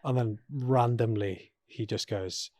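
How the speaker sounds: ordinary talk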